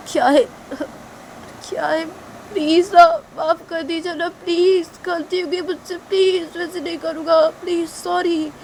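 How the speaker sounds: high whiny pleading; whimpering plea. Acted. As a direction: beg after being slapped. Culprit begs after slap.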